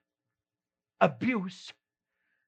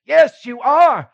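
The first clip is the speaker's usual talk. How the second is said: loud, slow, higher insistence; insisting loudly; 8 semitones higher, 13 dB louder, slower than usual. Acted. Courtyard accuser to Peter, loud slow insistence. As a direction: pin him down, loud and slow.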